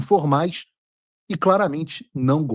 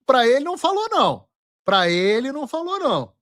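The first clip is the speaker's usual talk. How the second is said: high, stretched-out teasing denial; mocking sing-song; 9 semitones higher than usual. Real, not acted. Host answering the president's clip, high mocking sing-song. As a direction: taunt it high, dragging the words out.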